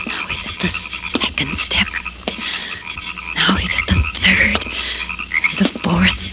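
whisper. Acted. Retelling a childhood ghost story, creeping.

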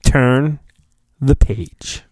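normal talking voice

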